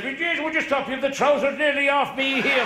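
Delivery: high loud outraged cry; hammed-up panicked shout; exasperated jarvey. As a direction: yell in comic alarm. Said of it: "the Jarvey said".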